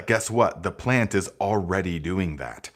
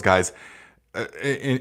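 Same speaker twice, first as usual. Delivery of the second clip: usual speaking voice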